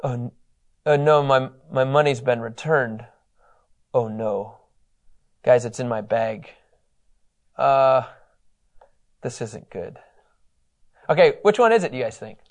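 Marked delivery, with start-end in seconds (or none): 0.0-10.0 worried dismay. Shown as a demonstration. Preacher acting a brother dreading his returned money. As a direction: mutter it like bad news sinking in. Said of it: "second option is this".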